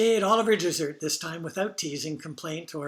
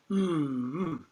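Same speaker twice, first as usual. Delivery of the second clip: teasing. Retold or imitated. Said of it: "teasing her with".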